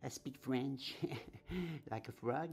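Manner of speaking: laughing through English in a French accent; joking French accent. Shown as a demonstration. Joking to viewers about her English, in a French accent. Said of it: "Excusez mon accent".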